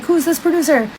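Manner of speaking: high-pitched mock demand; lightly acted indignant question; retold freak-out. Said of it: "He freaks out".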